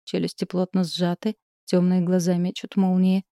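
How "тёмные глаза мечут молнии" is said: ordinary delivery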